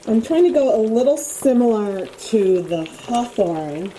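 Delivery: ordinary talk, nothing marked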